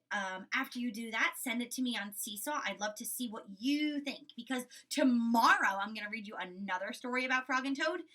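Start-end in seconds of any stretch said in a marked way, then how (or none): none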